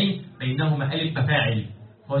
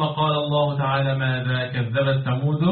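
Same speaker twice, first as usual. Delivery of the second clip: Quran recitation. Acted.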